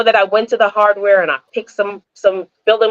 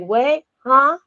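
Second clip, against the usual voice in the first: acted puzzled listener, higher on "Huh?"; mock confusion; 5 semitones higher than usual. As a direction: play a listener who didn't understand. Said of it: "They'll be confused by any country".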